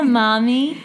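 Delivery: put-on cute toddler voice with a rising end; sweet childish delivery; cute little child. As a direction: lilt up like a little girl to her mother. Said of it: "I read it really cute".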